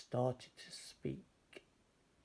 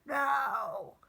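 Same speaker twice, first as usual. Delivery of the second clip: high, loud shriek of no; witch crying out; 6 dB louder than usual. Acted. Witch refusing the dragon, high frightened cry. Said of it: "cried the witch".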